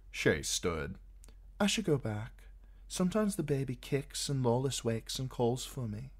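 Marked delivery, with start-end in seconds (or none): 1.6-6.1 put-on high, light young woman's voice; Shay's voice. A narrator's reading. Shay chattering to Tyrion in bed, high and eager.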